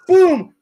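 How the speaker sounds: loud high mock shot; boom. Telling about shooting the squirrel, loud mock boom. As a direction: punch out the gunshot.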